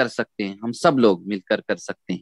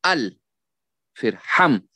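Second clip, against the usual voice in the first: "अल" and "हम" set apart by a pause; syllable drill. Shown as a demonstration. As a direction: practise अल, then हम, as separate syllables. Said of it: "तो पहले उसकी खूब मशक". (अल और हम अलग-अलग बोलें)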